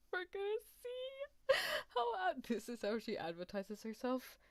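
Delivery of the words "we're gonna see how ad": slow, high squeal through laughter; cracking up. Real, not acted. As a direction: squeeze the words out in a high laugh. Cracking up on hearing the voice.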